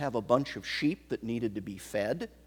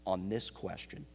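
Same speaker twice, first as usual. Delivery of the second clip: normal talking voice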